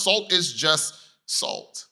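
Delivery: very slow, each word weighed; slow emphatic. Acted. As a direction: lay it down slowly.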